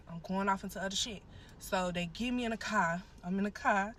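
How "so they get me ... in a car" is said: ordinary delivery, nothing marked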